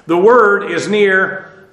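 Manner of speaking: slow, with the weight on "near"; stress on near. Shown as a demonstration. Teacher slowing down to stress "near" for students. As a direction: lean into "near" at the end. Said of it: "the emphasis is on near".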